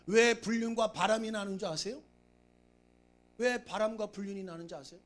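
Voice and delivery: usual speaking voice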